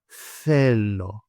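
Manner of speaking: "θέλω" opening on a long soft th. Shown as a demonstration. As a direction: stretch "θέλω" out as thhhe-lo. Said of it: "starting with the soft T H sound".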